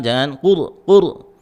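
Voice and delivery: ordinary delivery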